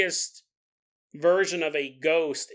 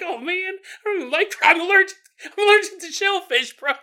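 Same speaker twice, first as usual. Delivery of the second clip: whiny allergic listener; 13 semitones higher, 6 dB louder than usual. Retold or imitated. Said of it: "You're like".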